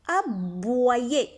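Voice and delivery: "Aboyer" said a-bwa-yé, drawn out; correct reading. Shown as a demonstration. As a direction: sound out "Aboyer" with a wa glide, a-bwa-yé. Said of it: "On ne dit pas aboyer".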